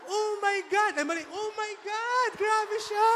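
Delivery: high, loud shocked cry; shocked exclamation. Acted. Gossiper reacting to a shocking sight, high and loud. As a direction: shriek in mock horror.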